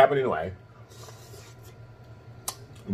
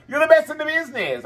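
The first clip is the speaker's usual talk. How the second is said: much higher, gushing praise for her fans; 14 semitones higher than usual. Real, not acted. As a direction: rave at them, pitched way up.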